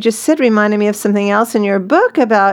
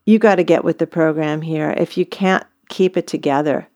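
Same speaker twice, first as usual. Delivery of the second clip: low, quiet, firm reprimand; hushed, lowered voice of parental authority; dad taking daughter aside; 6 semitones lower, 4 dB quieter than usual. Pretend. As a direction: play a dad privately setting limits. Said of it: "taken her aside and said".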